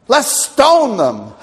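slow, loud mock mob call; a hint of an angry crowd; grumbling mob voice. Preacher mocking the grumbling majority, mock angry call.

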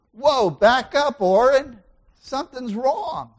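slow, loud high call to himself; self check. Shown as a demonstration. Pastor stopping his own frustration, slow loud self-call. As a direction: rein yourself in, drawn out and loud. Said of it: "Your mind's not in the right place".

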